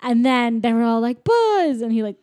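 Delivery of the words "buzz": high, loud exclamation; mock delighted recognition. Toys recognising old Buzz, mock amazed cry. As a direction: pitch it way up in recognition.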